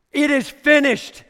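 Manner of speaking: high, loud "It is finished" shouted out; triumphant proclamation. Acted. Preacher declaring victory to the congregation, shouted.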